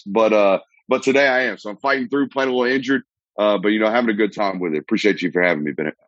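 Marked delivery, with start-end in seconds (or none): none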